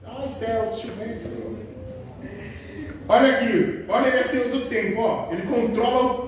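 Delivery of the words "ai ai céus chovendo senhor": soft, sighing complaint about the rain; a softer mock moan over the rain; sighing grumble. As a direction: moan quietly to the Lord about the weather. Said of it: "tá murmurando".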